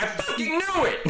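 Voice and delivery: high, laughing mock fury; mock angry outburst. Camille in the Japanese version, high laughing mock rage. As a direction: blurt it out, half laughing and pissed. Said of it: "he's pissed".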